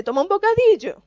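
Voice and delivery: high, casual offer of a sandwich; offhand helper. Pretend. Preacher voicing a helper offering a sandwich, lightly mocking. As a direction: hand it over breezily.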